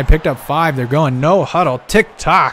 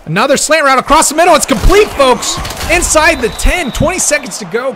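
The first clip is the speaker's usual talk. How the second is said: fast, much higher and louder rapid call; excited play-by-play; 9 semitones higher, 5 dB louder than usual. Real, not acted. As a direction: blurt it out as the catch happens. Big completion inside the ten.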